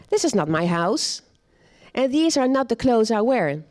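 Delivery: usual speaking voice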